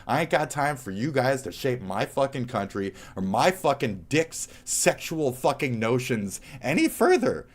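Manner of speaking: ordinary delivery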